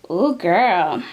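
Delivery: ordinary talk, nothing marked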